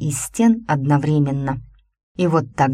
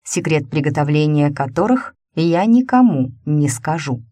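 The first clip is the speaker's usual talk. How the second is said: plain reading voice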